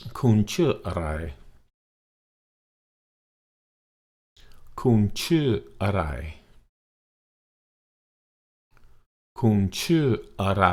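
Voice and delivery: teacher modelling Thai; Thai demo phrase. Shown as a demonstration. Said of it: "So repeat after me".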